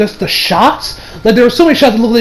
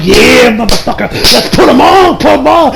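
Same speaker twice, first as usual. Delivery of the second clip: higher, slow, eager egging on; hyped up; 4 semitones higher, 3 dB louder than usual. Retold or imitated. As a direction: urge the hero to put on the shades. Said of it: "I'm like".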